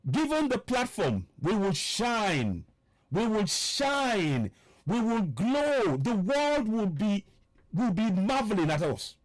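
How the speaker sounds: slow, declaimed political speech with long-held key words; rousing oratory. Real, not acted. Broadcaster tells listeners their people will shine, rousing oratory. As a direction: proclaim it slowly, stretching SHINE and GLOW.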